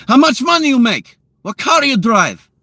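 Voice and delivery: mock Russian woman firing off nosy questions. Russian women grilling a guy they meet, high mock Russian accent. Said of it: "the Russian women ... they're like".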